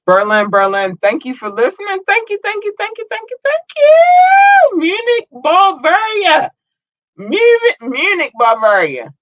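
high hyped-up shout-out; excited shouting. Real, not acted. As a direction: yell the city names like a hype man. Hyped shout-outs to cities.

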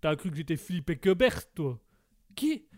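ordinary delivery

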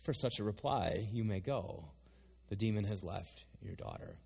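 low, soft, measured pronouncement; calm Jesus. Acted. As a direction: grant it quietly, with pauses. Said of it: "And Jesus goes".

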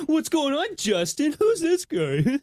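high, slow teasing voice; high slow mocking friends. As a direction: tease him with a high drawn-out voice. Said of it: "both look at him and go".

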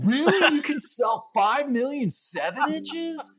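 high, loud, gullible amazement; mock astonished bro. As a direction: ham up a dim guy who believes it. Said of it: "he's all".

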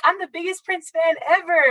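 high-pitched mock gushing; mocking fake fans. Real fan imitates people she knows, mocking.

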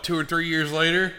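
ordinary talk, nothing marked

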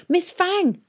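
very high, alarmed call; shouted. A narrator's reading. Boy calls to the fighter being attacked, shouting. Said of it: "shouted Tom".

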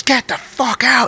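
high, drawn-out mock yell; imitated angry order. Husband ordering guests out, imitated angry yell. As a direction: stretch each word high, without real volume. Acts enraged man ordering them out.

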